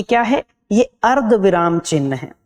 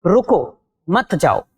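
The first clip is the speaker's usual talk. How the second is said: slow, with a clear break after रुको, then मत जाओ together; pause after रुको; 2 semitones lower, slower than usual. Shown as a demonstration. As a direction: say रुको, stop briefly, then मत जाओ as one unit. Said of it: "हमने लगाया अल्प विराम चिन्ह".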